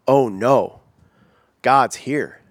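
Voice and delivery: drawn-out oh-no with pauses, dreading it; dismayed. Acted. Preacher acting out dread when God shows up, dismayed. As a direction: let it sink in, broken by a heavy pause. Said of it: "Not like, oh yay ... We go".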